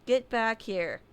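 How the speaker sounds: ordinary delivery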